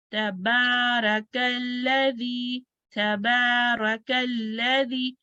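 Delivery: slow tajweed reading, long vowel in तबारकल्लज़ी; measured recitation. Shown as a demonstration. Teacher models practice verse for students, measured recitation. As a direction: stretch the long vowels of तबारकल्लज़ी on a level note. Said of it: "टू counts देना है".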